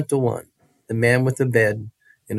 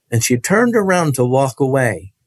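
ordinary delivery

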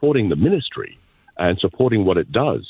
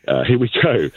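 ordinary talk, nothing marked

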